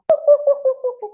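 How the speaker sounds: very high, loud ooh-oohs; happy monkey hoots. Acted. Baby monkeys all so happy, high hooting. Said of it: "baby monkeys would all be so happy".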